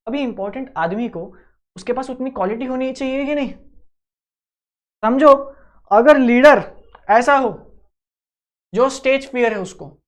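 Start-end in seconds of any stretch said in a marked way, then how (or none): 5.0-10.0 loud, slow, emphatic point. Real, not acted. Teacher urging the class: loud, slow, emphatic. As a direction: lean hard on each word. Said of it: "stressing a point to class".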